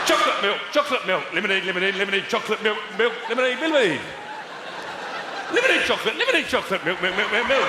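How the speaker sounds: frantic high-pitched choreographer barking cues; shouting it out. Acted. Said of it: "choreographer at the side of the stage shouting it out".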